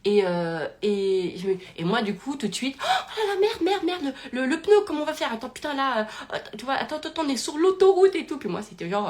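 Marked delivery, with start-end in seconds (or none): 2.8-8.4 high, breathless flustered rush; hammed-up alarm, words tumbling out; mock panic. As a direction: act out panicking on the motorway. Acting out tyre panic.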